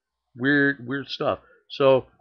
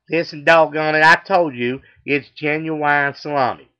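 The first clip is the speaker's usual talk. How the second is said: loud, raised Texan twang; insistent southern accent; 3 semitones higher, 7 dB louder than usual. Acted. Customer corrects the deli clerk back, loud southern accent. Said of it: "he's got a southern accent".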